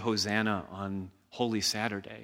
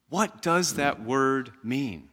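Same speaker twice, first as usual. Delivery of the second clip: ordinary talk, nothing marked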